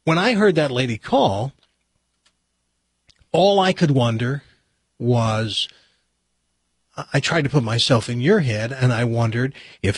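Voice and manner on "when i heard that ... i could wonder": normal talking voice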